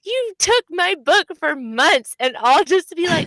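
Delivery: hammed-up complaint at the friend; mock outrage. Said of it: "I'm so angry".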